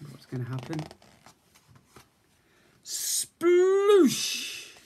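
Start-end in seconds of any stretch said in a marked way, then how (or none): 2.8-4.8 high, loud splash noise; acting out the cub falling in; big splash. Splash sound in story.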